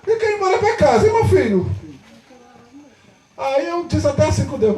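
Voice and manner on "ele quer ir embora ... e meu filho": high-pitched acted objection; impatient parent. Parent to pastor after the service ended, impatient. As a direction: imitate a parent pressing the pastor.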